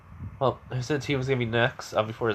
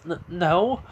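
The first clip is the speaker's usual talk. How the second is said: flustered stammer; 6 semitones higher than usual. Acted. Flustered Momo denies jealousy.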